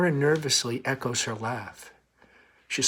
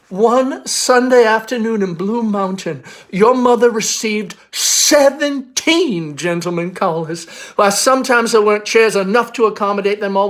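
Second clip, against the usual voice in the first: higher, louder, proud Southern belle; reminiscing belle; 8 semitones higher, 10 dB louder than usual. Acted. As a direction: boast of her callers. Amanda reminisces grandly.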